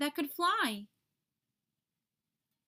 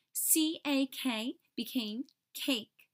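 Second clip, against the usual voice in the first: usual speaking voice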